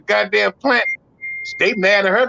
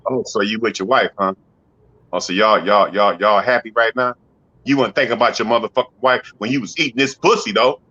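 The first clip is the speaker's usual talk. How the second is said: bitter jealous lover; 9 semitones lower than usual. Retold or imitated. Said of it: "Sad bitch like this".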